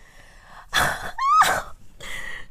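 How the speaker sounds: very high squealing giggle; shrill giggle. Acted. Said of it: "Shrills Kissy while giggling".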